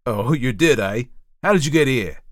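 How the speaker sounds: loud, disbelieving judge; loud, incredulous interrogation; sceptical magistrate. A narrator's reading. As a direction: fire the questions at the prisoner. Magistrate sceptically questions the prisoner.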